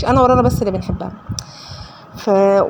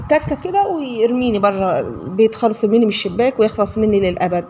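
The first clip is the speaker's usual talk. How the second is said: ordinary delivery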